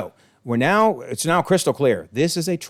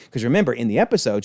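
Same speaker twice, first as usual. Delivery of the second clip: usual speaking voice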